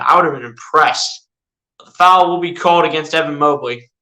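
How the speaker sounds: ordinary talk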